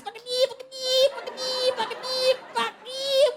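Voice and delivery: high squealing fucking yeah; dolphin-like surfers. Retold or imitated. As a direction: squeal like dolphins calling in the sea. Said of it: "All you can hear all around you is".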